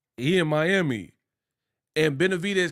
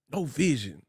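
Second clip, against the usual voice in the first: Stack impression; 3 semitones lower, 4 dB quieter than usual. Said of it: "I'm on my Stack".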